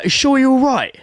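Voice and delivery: loud, high call through the cubicles; imitated worried neighbour. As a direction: act out shouting over to check on him. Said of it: "I had the other guy".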